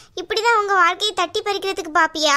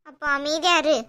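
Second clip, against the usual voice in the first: ordinary talk, nothing marked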